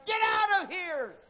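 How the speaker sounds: high pitched shout at the leper. A narrator's reading. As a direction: drive him off with a shout.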